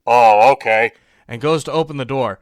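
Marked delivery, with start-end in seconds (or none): 0.0-0.9 low, loud dopey voice; dim-witted parody soldier; mock soldier. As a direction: play a gullible guard giving in. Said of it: "the soldier's like".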